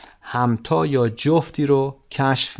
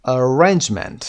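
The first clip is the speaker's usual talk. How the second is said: arrangement with RANGE stressed; second-syllable stress; 4 dB louder, slower than usual. Shown as a demonstration. Teacher shows the stressed syllable, ar-RANGE-ment. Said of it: "second syllable is stressed".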